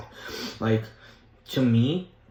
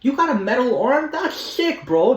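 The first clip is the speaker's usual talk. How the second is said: put-on much higher and louder, a starstruck kid gushing; fangirling Peter; 13 semitones higher, 7 dB louder, slower than usual. Peter fangirling over an enemy's metal arm, thrilled high squeal. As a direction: squeal it like a teen meeting his hero mid-fight. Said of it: "fangirling over everyone while he's fighting them".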